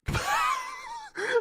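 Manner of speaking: sudden shrieking giggle; high squealing laugh. Real, not acted. Streamer squeals with laughter at her own silliness.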